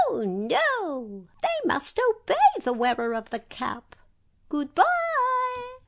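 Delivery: very high tiny voice; little mouse explaining; squeaky mouse queen. A narrator's reading.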